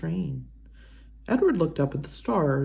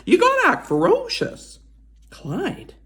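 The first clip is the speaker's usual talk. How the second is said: put-on much higher, louder old lady voice; old woman voice; 4 dB louder than usual. Old woman urging her rabbit scarecrow, high and loud. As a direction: urge him on in a high old voice.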